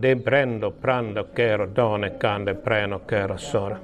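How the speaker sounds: low, sinking glossolalia chant; speaking in tongues. Real, not acted. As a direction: let the tongues fall away into a hush. (kielillä puhuminen)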